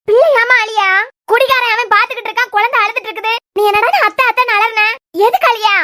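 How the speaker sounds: loud, furious demand; irate and alarmed, at full volume; angry scolding. Acted. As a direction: shout an angry question at the household. Mother scolds over crying baby.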